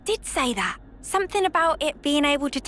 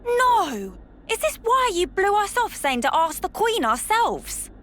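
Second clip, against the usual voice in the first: dismayed outburst; 6 semitones higher than usual. Acted. Dismayed at news of lost powers.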